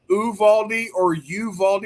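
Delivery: "Uvalde" said first with an OO start, then a YOU start; two rival pronunciations. Shown as a demonstration. Co-host asking which way the town is pronounced, two rival pronunciations. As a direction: contrast OO-valdy with YOU-valdy on "Uvalde". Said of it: "how is that pronounced correct".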